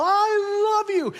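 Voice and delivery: high, drawn-out loud holler; yelled. Acted. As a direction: yell it joyfully from the doorstep, stretching it out. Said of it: "and then yell".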